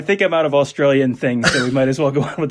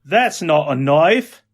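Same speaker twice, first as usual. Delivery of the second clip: put-on slow, louder Australian accent; drawn-out Aussie movie quote, lifting at the end; hammed-up Australian accent; Crocodile Dundee; 5 dB louder, much slower than usual. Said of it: "stuff that Crocodile Dundee says".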